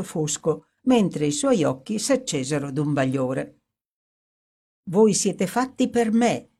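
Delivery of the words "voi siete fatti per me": ghastly gaiety. A narrator's reading. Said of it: "esclamò con orrenda gaiezza".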